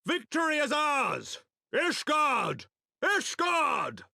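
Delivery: high, drawn-out triumphant call falling away; victory cry. Acted. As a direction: cry out the win to rally your side. Victory cry after winning battle.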